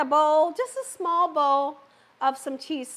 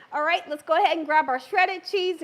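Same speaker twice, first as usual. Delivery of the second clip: ordinary delivery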